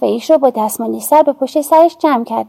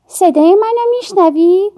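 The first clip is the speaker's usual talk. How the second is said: higher, slow, as if not her own voice; a slow, higher, otherworldly call; shadow voice; 6 semitones higher than usual. A narrator's reading. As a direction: speak in her shadow's voice, drawn out. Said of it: "نه با صدای خودم، که با صدای سایم حرف زدم".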